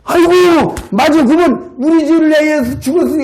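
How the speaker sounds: high, loud "aha" of sudden understanding; light up as it finally clicks; dawning realization. Retold or imitated. Old man suddenly understanding the gospel, excited aha. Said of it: "문제가 풀리는 거야".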